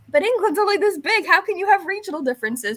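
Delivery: high, mock-baffled disbelief; high mock astonishment; incredulous American. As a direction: play the puzzled American, pitched high. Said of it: "we're like".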